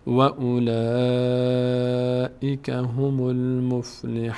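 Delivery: chanted slowly, the ن of المفلحون kept silent; slow recitation. Shown as a demonstration. Teacher demonstrating a still nun, slow chant. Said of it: "دقت کنید که نون ساکن حرکت نکنه".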